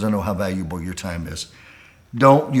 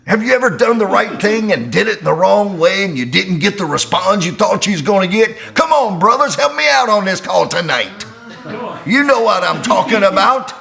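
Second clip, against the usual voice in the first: high, loud preaching run; full-force preaching; 11 semitones higher, 7 dB louder than usual. Acted. Preacher rallying the men on the call, loud and high. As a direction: rouse the brothers to answer back, shouting.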